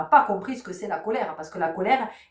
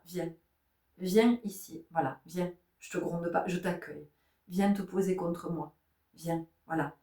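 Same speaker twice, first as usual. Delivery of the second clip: put-on soft, tender calling the child close; soothing parent; 2 semitones lower, 7 dB quieter than usual. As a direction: gently welcome the upset child. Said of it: "lui dire".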